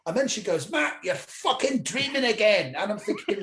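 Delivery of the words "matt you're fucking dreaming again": higher, drawn-out exasperated scolding; angry wife. Retold or imitated. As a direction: imitate a wife fed up and telling him off. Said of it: "the reason she was angry".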